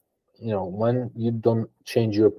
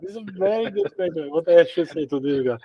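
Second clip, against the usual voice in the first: high-pitched, laughing imitation of thinking aloud; mock stalling guest; 8 semitones higher than usual. Guest mimics podcast interviewees stalling, high and laughing. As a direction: play a stumped guest buying time, amused.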